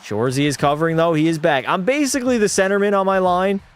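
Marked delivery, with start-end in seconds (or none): 0.0-1.7 hockey announcer calling the action; play-by-play call. Acted. Streamer calling his own hockey game as announcer. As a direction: call the rush like a TV broadcaster.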